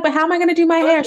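high, fast, fretting daughter; mock panicky kid. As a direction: rush it out like a worried kid. Daughter's panicked question voiced.

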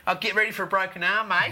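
tossed-off mock threat; quick imitation of a sledging batsman; mock Michael Clark sledge. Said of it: "Michael Clark going up to Jimmy Anderson going".

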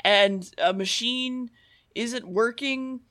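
ordinary talk

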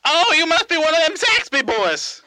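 high, silly phone-character voice; Tony Zamboni. Acted. Tony sizing up his caller, high put-on voice. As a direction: pitch it far above your own. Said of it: "Let's, let's call Tony".

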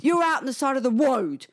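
high imitation of an angry little boy, "road" as "woad"; retold childish shout with a W for R. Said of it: "he's in there shouting things".

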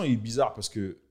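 normal talking voice

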